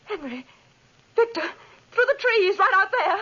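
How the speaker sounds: high, crying shout of terror; terrified scream. Acted. Beth spotting the monster through the trees, terrified scream.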